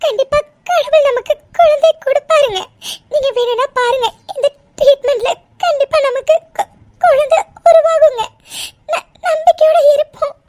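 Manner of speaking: tearful, breaking voice; crying reassurance. Acted. Wife comforting her husband through tears. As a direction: comfort him while crying.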